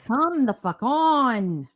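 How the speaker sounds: loud, slow exasperated groan; echoing her friend, fed up with the weed eater, slow and loud. Pretend.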